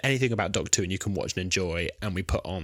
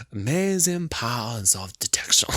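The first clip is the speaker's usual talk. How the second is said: slow, drawn-out detective parody; Poirot impression; 2 semitones higher, slower than usual. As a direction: stretch it out like a theatrical sleuth. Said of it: "when she's doing the Poirot impression".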